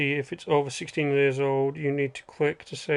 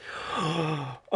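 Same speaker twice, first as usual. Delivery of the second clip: a sharp gasp, much softer than usual talk; surprised gasp; 7 dB quieter than usual. Real, not acted. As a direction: catch your breath in surprise. Reacting to a viewer's accent guess.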